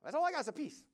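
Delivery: high, lilting voice of the woman from the restaurant; imitating the woman. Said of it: "She's telling me, right?".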